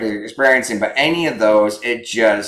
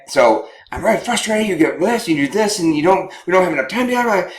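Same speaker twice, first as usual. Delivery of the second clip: high-pitched frustrated complaint, acted out; venting wife; 8 semitones higher than usual. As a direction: rattle off a mock gripe, voice pitched up.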